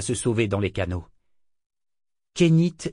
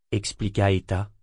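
ordinary delivery, nothing marked

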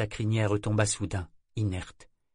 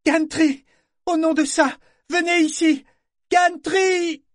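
much higher and louder desperate scream; 9 dB louder than usual. A narrator's reading. Vivacia screaming for Gantry across the ship. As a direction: throw her head back and scream for help.

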